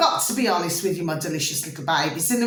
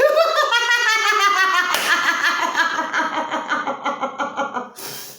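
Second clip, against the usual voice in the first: a long high laugh, loud, falling away; big laugh; 12 semitones higher, 3 dB louder than usual. Real, not acted. Laughing after teasing her babies about her nightwear. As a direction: burst out laughing at her own cheeky remark. Laughs at own joke to viewers.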